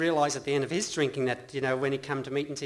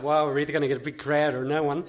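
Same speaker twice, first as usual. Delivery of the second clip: loud, laughing thought; 5 dB louder than usual. Real, not acted. Laughing at the announcement.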